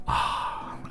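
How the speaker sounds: normal talking voice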